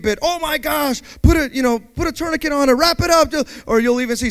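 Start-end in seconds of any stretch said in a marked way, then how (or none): 0.2-3.3 mock panic. Mock panicked advice quote.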